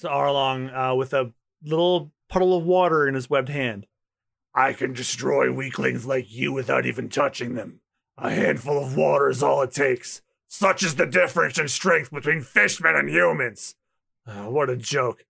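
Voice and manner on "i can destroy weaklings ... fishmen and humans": lightly voiced low villain; low menacing villain. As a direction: threaten the weaklings coldly. Voicing villain Arlong.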